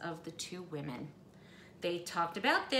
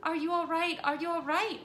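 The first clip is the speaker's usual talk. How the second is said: high, louder anxious cry; cried out in alarm; 9 semitones higher, 5 dB louder than usual. Acted. Missus Prim to her fallen guest, alarmed high cry. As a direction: call out worried as they scramble up. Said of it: "Missus Prim cried out".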